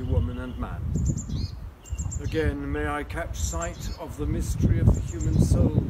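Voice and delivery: solemn spoken prayer. Acted. Leads an opening prayer.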